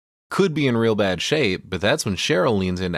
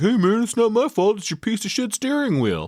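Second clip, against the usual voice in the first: defensive dude voice. Retold or imitated. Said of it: "Scott's all like".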